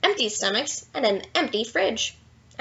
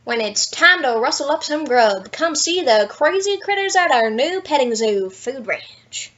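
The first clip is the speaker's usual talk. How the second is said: loud, hammed-up commercial voice; cowboy ad announcer; ad read; 5 dB louder than usual. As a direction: sell it like a cheesy radio ad. Billboard ad jingle read.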